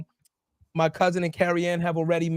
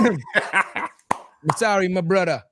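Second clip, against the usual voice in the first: put-on drawn-out West Indian accent apology; much slower than usual. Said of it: "working on this accent all episode".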